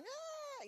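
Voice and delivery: put-on a sudden, very high, quick baby wail; tiny squealing newborn cry; imitated newborn cry. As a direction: mimic a newborn crying in the plastic crib. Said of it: "he starts crying".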